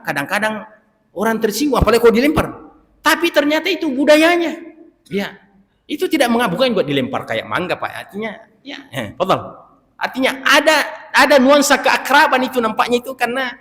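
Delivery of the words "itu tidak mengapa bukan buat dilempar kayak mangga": ordinary delivery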